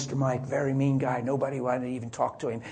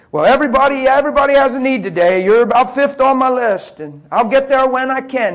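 much higher and louder sing-song brush-off; preacher mocks mean forklift driver putting people off; mocking impression; 9 semitones higher, 13 dB louder than usual. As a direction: lampoon a smug stalling coworker. Mocking Mike's stalling reply.

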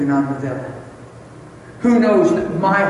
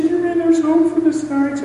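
high-pitched, astonished question; imitating hopeful wife; 12 semitones higher, 3 dB louder than usual. Wife asking husband if hope remains, imitated high voice. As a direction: mimic her in a high voice.

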